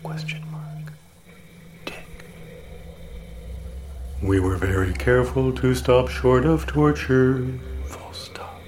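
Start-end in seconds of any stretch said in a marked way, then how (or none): 0.0-2.3 punctuation breathed in a whisper; whispered dictation. Acted. Reading punctuation aloud. 7.9-8.6 punctuation breathed in a whisper; whispered dictation. Acted. Reading punctuation aloud.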